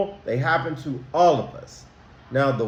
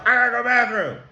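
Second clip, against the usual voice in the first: higher, blurted announcement; announcing loudly; 7 semitones higher, much faster than usual. Shown as a demonstration. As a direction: blurt it out to the whole row. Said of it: "You don't have to announce".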